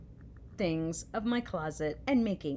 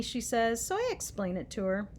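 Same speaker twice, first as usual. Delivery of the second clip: plain reading voice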